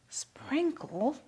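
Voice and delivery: whispering a kitchen secret; whispered secret. Real, not acted. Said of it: "this is a little secret".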